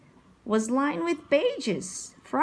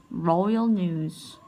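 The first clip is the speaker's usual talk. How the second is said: plain reading voice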